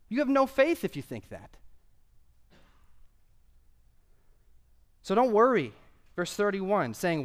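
high, punchy pulpit exhortation; emphatic preaching. Real, not acted. Preacher urging the guys not to worry, high emphatic exhortation. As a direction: press the point home, pitch lifted.

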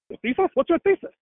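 high-pitched questioning, taken aback; puzzled challenge, voice lifting high; incredulous fox. Acted. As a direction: question it in lightly acted disbelief. Fox challenges rabbit in joke.

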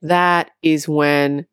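usual speaking voice

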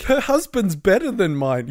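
lightly mocking envy; jealous whine. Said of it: "So it's like".

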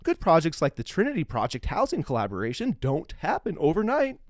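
measured, formal read-aloud of a prepared text; formal statement read. Acted. Podcast host reads a charity statement, formal read.

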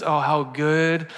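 slow, soft and reverent; tender psalm reading. Acted. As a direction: savour each word like a prayer. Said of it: "when he says".

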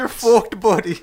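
high squeaky mock taunt. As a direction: jeer it in a high put-on voice.

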